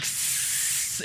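imitated bottle warmer hiss; long, soft steam hiss. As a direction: hiss like a hot appliance. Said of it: "it hisses".

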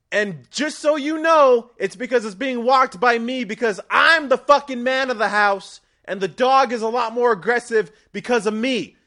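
high, loud ranting husband voice; angry husband yelling. Acted. Macho husband yelling at his wife to walk the dog, angry high shout. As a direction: bellow at the wife from across the house. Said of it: "wow, a little bit of anger there".

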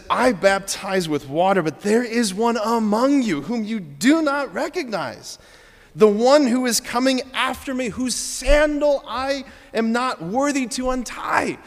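high, stretched, radiant announcement; joyful proclamation. Acted. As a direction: proclaim it slowly, soaring with joy. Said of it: "there is joy radiating out of John".

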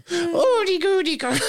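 very high nonsense squeal; squeaky creature babble. Acted. Podcaster giving up on the yattering voice, squeaky babble. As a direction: gabble like a tiny cartoon imp. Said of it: "high-pitched West Country accent".

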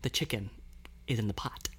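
low, quiet secret code line; spy code voice. Pretend. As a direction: murmur it like a secret agent. Quoting the commercial code line.

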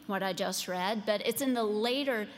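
ordinary talk, nothing marked

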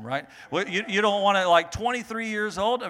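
ordinary talk

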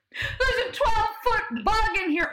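high, loud retold cry of fright; hammed-up alarm; mock panic. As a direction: holler it like a man scared of a bug. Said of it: "And Chance goes".

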